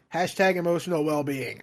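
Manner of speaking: ordinary delivery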